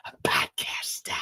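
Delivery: excited wife dropping into a whisper; awed stage whisper. Acted. Wife selling husband on podcast stardom, awed whisper. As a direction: whisper it like a big dream.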